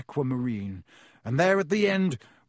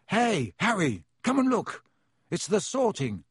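higher-pitched, eager call to a friend; excited calling; 5 semitones higher than usual. A narrator's reading. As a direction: beckon a friend over to see something. Ron calls Harry to the window.

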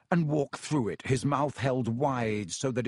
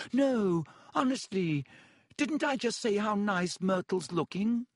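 flustered high reassurance; 8 semitones higher than usual. Acted. Desperate reassurance.

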